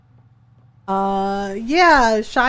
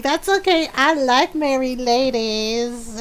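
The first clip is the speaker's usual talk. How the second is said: creepy flirt; 4 semitones higher, slower than usual. Retold or imitated. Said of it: "It's just like".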